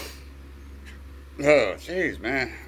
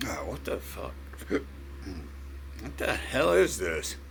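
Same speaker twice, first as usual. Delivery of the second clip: whispering; 6 semitones lower, 10 dB quieter, much slower than usual. Real, not acted. Stunned by hiccups, whispering.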